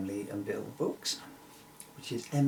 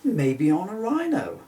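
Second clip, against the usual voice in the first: louder, higher eager cry; excited cry; 7 semitones higher, 7 dB louder than usual. Acted. Emily guessing the horn's owner, excited cry. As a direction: blurt it out with glee. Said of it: "Emily then excitedly cried".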